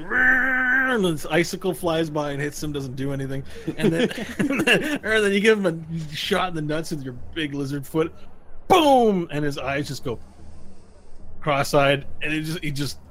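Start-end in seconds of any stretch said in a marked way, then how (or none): 0.0-0.9 high, drawn-out revving noise; imitated chainsaw. Game master imitates the chainsaw in the orc, revving noise. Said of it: "chainsaw stuck in him. He's like". 8.7-9.3 loud, high "boom" effect; impact boom. Acted. Game master lands the lizard-foot kick, loud boom.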